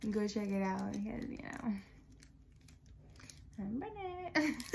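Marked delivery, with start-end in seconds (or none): 3.6-4.8 soft, slow, laughing it out; shy giggle on the news; giggly announcement. Real, not acted.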